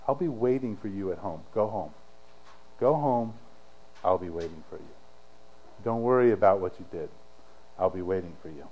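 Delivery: low, soft, unhurried comfort; quiet, low promise to wait for loved ones; gentle reassurance. Retold or imitated. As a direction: reassure them tenderly, letting the voice sink. Jesus gently reassuring his disciples.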